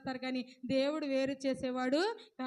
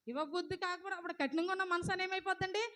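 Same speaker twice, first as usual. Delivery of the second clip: ordinary talk, nothing marked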